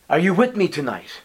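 normal talking voice

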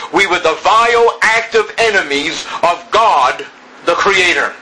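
climbing preacher's fervor; rising preaching. Acted. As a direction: drive it home to the congregation. Preacher builds to climax.

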